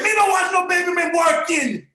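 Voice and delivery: high, loud shouted Jamaican refusal; mock Jamaican accent. Refusing his wife, shouts in a Jamaican accent. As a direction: bark it back in a put-on Jamaican accent.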